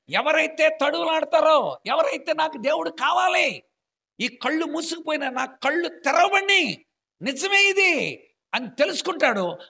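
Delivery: fiery preaching. Acted. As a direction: preach it out with full force. Preaching at full force. (ఉద్రేకంతో గట్టిగా బోధించడం)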